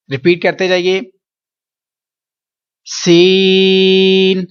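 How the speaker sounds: सीन held very long, not nasal; teacher chanting long सीन; drawn-out recitation. Shown as a demonstration. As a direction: stretch सीन three seconds. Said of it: "नाक में आवाज नहीं जाने देंगे".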